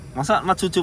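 normal talking voice

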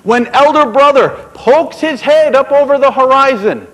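rally them for the flight; creator instructing birds. A narrator's reading. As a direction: creator giving the birds their task. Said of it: "so he said to all of them".

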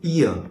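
"ear" nói rõ i rồi ơ, nuốt nhanh vào nhau; "ear" đọc i sang ơ liền mạch, nhanh; gliding ear diphthong. Shown as a demonstration. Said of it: "đọc i rõ và ơ rõ nhưng mà lại nuốt nhanh vào nhau". (đọc "ear" như nguyên âm đôi, lướt nhanh từ i sang ơ)

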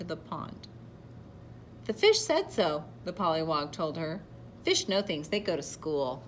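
animated read-aloud with deliberate emphasis; picture-book narration. A narrator's reading. Reader telling children of a proud caterpillar, storybook narration. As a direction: read to children, projecting key words.

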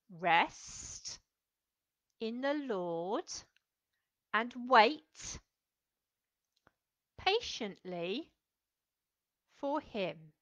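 each phrase slow with long pauses; slow, spaced recitation. Shown as a demonstration. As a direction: say it slowly, one sign at a time. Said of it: "we're going to go like this".

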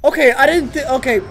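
sudden loud scared shout; startled yell. Real, not acted. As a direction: jump out of your skin. Jump scare in horror game.

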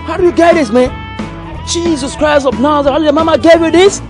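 high-pitched, awestruck catcall; amazed exclamation. Acted. Show-off exclaims at a woman.

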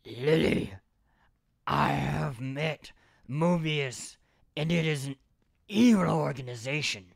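a mock tough-guy soldier, dragged out and pushed; Solid Snake voice. Friend asked for it, so he hams up a slow gruff-soldier delivery. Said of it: "Do you want me to do an impersonation?".

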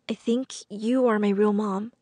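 plain reading voice

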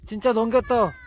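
high, loud, repeated home run cheer; home run call. Real, not acted. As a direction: shout it like a fan in the stands.

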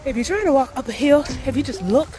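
high and loud, pushing over the effort; talking while hiking. Real, not acted. Vlogger giving hill-walking tips while climbing, loud. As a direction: call your tip out mid-climb.

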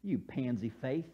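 low, soft jibe; understated put-down. Retold or imitated. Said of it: "This is how I would say it".

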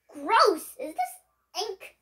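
loud grossed-out yell; disgusted shout. Acted. Sprayed with ink.

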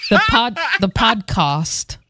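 attempted British accent, slow; drawn-out mock British "podcast". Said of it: "I can't even do a British accent".

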